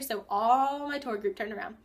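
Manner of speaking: usual speaking voice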